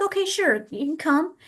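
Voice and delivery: high, light agreement; agreeable parent. Retold or imitated. Parent saying the worker can come, high and agreeable. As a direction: imitate a willing parent, pitched well up.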